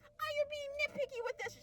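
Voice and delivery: very high-pitched complainer imitation; mocking critics. Viewers objecting to her review, mocking high voice. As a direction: put on a squeaky objecting voice. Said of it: "I know people are gonna be like".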